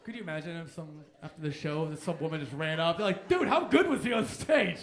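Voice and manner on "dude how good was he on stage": put-on loud, slow, gushing girl asking her friend; mock starstruck fan. Woman gushing to her friend after the show, loud and slow.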